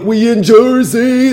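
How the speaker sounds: high, loud, drawn-out crowd cheer; fist-pumping party holler. Acted. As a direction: holler it long and high like a hyped clubber. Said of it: "like, fist pumping".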